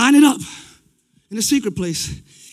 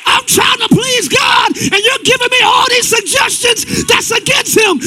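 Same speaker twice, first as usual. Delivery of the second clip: yelling; 11 semitones higher, 5 dB louder than usual. Real, not acted. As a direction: shout it down at the thought, much higher. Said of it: "Sorry for yelling at you".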